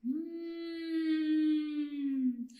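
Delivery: long, drawn-out "hmm"; interested, lingering hum; pondering hum. Acted. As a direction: hum slowly as if noticing something. Pigeon spots the grain.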